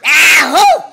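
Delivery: loud imitated sneeze ahoo; mock sneeze. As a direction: fake a big sneeze. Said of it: "What does Mama say when she sneezes?".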